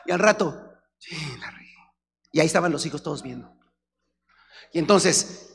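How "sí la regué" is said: sheepish mumble. Acted. Voicing a sheepish husband.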